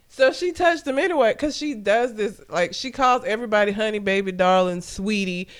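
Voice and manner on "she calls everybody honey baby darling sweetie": usual speaking voice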